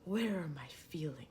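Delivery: whispered "Where are my feelings?" like an inner voiceover; hushed whisper, imitating a film's disembodied thoughts; movie thought-voice whisper. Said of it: "he has this voiceover thing and he goes".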